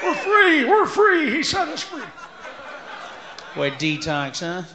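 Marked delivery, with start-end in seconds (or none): 0.0-2.0 high, giddy cries of freedom; high joyous shout fading into a laugh; overjoyed cheer. Pretend. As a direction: celebrate like you just escaped.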